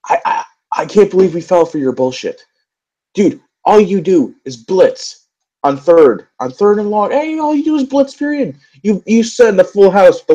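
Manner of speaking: incredulous rant. Real, not acted. Ranting directly at Greg Williams.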